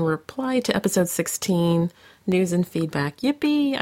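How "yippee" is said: a squeaky high yippee. Acted. Host reading a fan's level-up post, high yippee. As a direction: pop it up like a gleeful kid.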